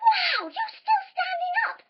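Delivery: high, loud mock astonishment; squealed, impressed exclamation; amazed wow. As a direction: marvel hugely at the children. Amazed children did not fall.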